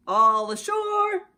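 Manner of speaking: high, loud, drawn-out call; ship's crew shouting. A narrator's reading. Sailors calling everyone ashore in New York. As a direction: call it across the dock. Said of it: "the men shouted".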